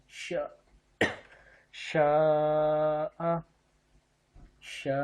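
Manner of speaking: شا with the آ held for four alifs; long madd. Shown as a demonstration. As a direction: stretch the vowel of شا long and steady. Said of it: "چار الف کے برابر لمبا کرتے ہیں".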